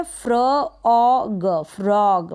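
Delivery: Frog drawn out slowly, sound by sound; slow phonics blend "fr-o-g". Shown as a demonstration. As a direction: stretch frog into "fra - aw - ga". Teacher sounds out a new word.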